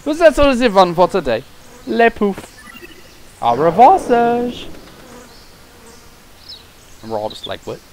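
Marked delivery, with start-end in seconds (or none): 0.1-4.7 hammed-up bad French accent, raised; mock French accent, playful; awful French accent. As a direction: ham up a terrible French accent. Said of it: "the French accent is awful".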